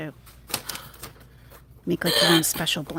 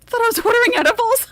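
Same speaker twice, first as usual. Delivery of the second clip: much higher, fast squeaky voice; mock high voice; 8 dB louder, faster than usual. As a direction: squeak it out quickly, joking.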